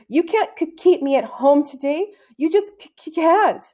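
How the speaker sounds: pitched high, tripping on the k and c; nervous boy stammering, refusing to stay home; insistent stammer. Acted. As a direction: stammer but insist hard. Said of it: "he had developed a slight stammer".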